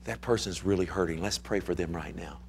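low, very soft murmur to wife; quiet, low-pitched aside; confidential aside. Retold or imitated. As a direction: lean in and say it privately, hushed. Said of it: "I lean to my wife".

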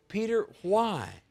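high, drawn-out appeal; pleading question. Acted. Preacher asks the absent Peter about his denial, slow pleading question.

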